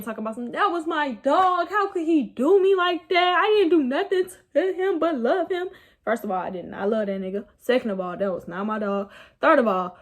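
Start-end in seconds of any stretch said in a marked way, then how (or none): 0.5-5.7 mock crying. Drunk woman crying over a man, mock-wailing.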